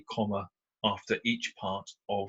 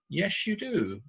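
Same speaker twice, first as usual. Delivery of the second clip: ordinary delivery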